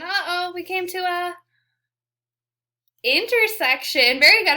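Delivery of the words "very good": much louder, higher cheer; excited praise. Real, not acted. Teacher cheering the class for remembering, loud and high. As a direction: burst out with praise. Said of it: "I heard some of you".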